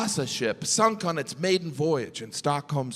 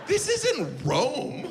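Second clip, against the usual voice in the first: mock exasperation; 10 semitones higher, much slower than usual. Sarcastic sauna nudity quip.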